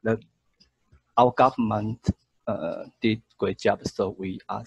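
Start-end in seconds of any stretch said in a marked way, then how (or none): none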